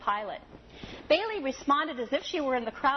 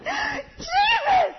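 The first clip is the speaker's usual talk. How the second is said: very high, loud joyful shriek with laughter and tears; little girl shouting in overwhelming joy; ecstatic child's cheer; 8 dB louder, slower than usual. Retold or imitated. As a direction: cheer like a child seeing a miracle. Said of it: "touchdown cheer couldn't come close".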